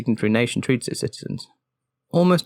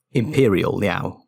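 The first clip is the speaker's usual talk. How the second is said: slow, heavy weight on "Imperial", Liao trailing after; stress on Imperial; 4 semitones lower, much slower than usual. A narrator's reading. Farn pressing the guards with the word, stress on "Imperial". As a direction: lean hard into "Imperial", drawn out. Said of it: "as much emphasis on the word Imperial".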